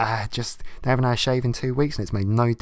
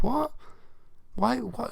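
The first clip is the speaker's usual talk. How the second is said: 1 incredulous what; 13 semitones higher, 5 dB quieter, slower than usual. Real, not acted. Baffled outburst.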